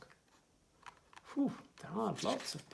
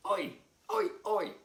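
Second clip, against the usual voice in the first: frog shouting; 6 semitones higher, 6 dB louder than usual. Acted.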